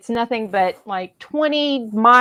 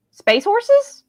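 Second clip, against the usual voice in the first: much higher mocking pitch on space horses; incredulous scoff; 12 semitones higher than usual. Fan mocking the film's plot, with an incredulous high-pitched scoff. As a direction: sneer it out in disbelief.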